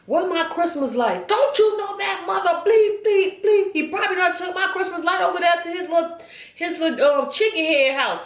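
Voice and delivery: imitating mama's rising outrage; angry rant. Mama ranting about her stolen Christmas lights, high and furious.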